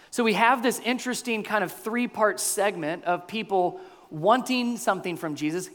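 ordinary talk

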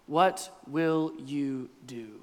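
low, slow, spaced-out question; deliberate word-by-word challenge; slow repeat. Acted. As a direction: draw out each word, low. Slowly repeating the crowd's phrase.

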